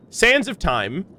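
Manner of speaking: normal talking voice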